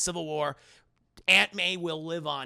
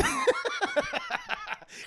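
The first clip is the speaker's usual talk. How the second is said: high laugh. Real, not acted. Laughs at own joke.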